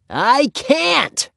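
put-on very high little-girl whine; frustrated child. A narrator's reading. Ramona struggles with the padlock for Henry, frustrated. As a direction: protest helplessly in a small child's voice.

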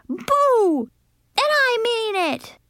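high, insistent shout. A narrator's reading. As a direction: shout it high, determined to scare. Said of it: "I shouted".

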